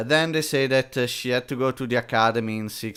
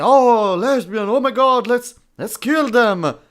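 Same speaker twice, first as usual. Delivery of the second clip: mock outraged crowd; 8 semitones higher, 6 dB louder than usual. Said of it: "No one is saying".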